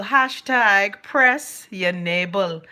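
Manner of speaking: slow, savoring each word; drawn-out mock slogan; slow emphatic. Real, not acted. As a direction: spell it out slowly like a hashtag. Mocking the viral phrase.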